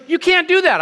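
high, loud alarmed protest; mock panicked protest. Stranger backing away from the preacher, mock panic.

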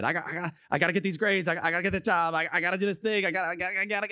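rushed, breathless run of worries; acted panicky, tumbling words; frantic inner chatter. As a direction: stammer through a racing to-do list. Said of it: "We have so much going in our head".

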